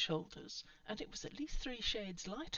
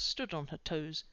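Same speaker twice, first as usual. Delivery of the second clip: ordinary delivery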